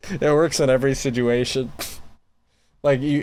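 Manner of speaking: usual speaking voice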